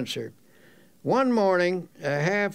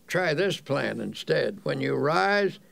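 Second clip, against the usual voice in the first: ordinary talk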